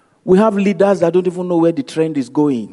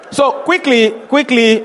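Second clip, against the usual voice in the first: urgent; 8 semitones higher, 4 dB louder than usual. Real, not acted. Urgent retelling.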